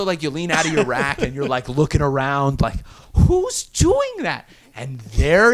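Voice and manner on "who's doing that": high, drawn-out mock bewilderment; baffled questioning. Recruit leaning out of the rack, high baffled question. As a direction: stretch it out, pitched up, incredulous. Recruit looking around the dark barracks.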